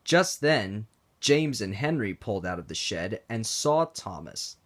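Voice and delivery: plain reading voice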